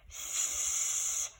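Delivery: long hissing sss sound; drawn-out s like a snake. Shown as a demonstration. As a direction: hiss the s out like a snake. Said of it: "It sounds like a snake".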